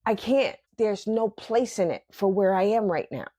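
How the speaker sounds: ordinary talk, nothing marked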